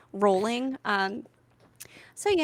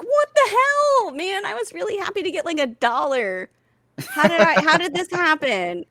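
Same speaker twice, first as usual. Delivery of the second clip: high, loud hammed-up indignation; mock outrage; 9 semitones higher, 6 dB louder than usual. Mom mock-complaining about her son's tooth fairy haul, high and loud. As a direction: play up the jealousy for laughs.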